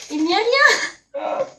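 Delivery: high, slow, loud mock dismay; fake disappointment. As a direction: pretend the box is empty. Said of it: "Non, je rigole".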